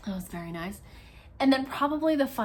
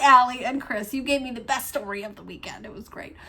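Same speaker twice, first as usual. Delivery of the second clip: usual speaking voice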